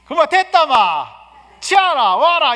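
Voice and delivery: gruff bossy shout. Shown as a demonstration. Bossy husband shouting orders at his wife, loud. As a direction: bark it like a bossy Gyeongsang husband. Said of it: "이게 아니라".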